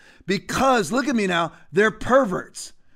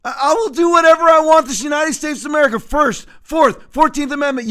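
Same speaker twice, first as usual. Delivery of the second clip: loud, high-pitched, heated defiance; indignant rant at raised volume and pitch; defiant outburst; 6 semitones higher, 6 dB louder than usual. Real, not acted. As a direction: fire it out as an angry declaration of rights. Defiant rant against Fourth of July limits.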